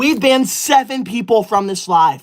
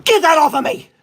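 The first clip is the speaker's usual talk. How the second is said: higher-pitched indignant yell; angry outburst; 4 semitones higher, 4 dB louder than usual. Real, not acted. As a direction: snap it out in exasperation. Angry reaction to cat-ear filters.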